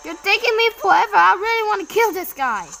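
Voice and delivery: high, loud, hurried shout; frustrated outburst. Real, not acted. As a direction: burst out fast and loud in frustration.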